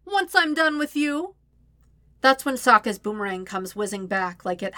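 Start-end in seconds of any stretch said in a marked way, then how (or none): 0.0-1.3 high, lilting threat; gloating singsong taunt. A narrator's reading. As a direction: sing the menace out sweetly. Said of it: "Azula sings".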